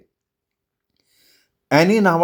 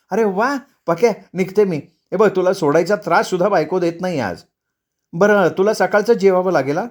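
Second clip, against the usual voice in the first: higher, lively wife voice; excited departing wife; 5 semitones higher than usual. Acted. Wife leaving for the safari tells husband, breezy. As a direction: play the wife eager to get away.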